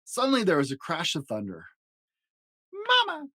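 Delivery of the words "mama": put-on very high little child's cry; a scared baby calling for mum; frightened little one crying out. A narrator's reading. Fluffy baby monster scared by thunder, crying Mama. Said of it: "cried the fluffy one".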